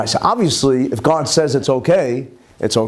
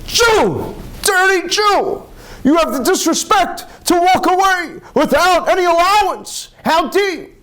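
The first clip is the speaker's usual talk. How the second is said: much higher, strained mock yell of the judges; angry mob yelling; slower than usual. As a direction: hurl the insult at him as he walks away. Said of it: "they started yelling".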